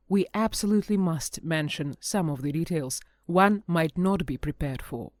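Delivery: plain reading voice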